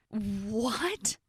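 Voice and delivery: drawn-out rising question; shocked disbelief. A narrator's reading. Bridesmaid hearing she'll be godparent with him, shocked. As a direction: balk in disbelief, pitch climbing at the end.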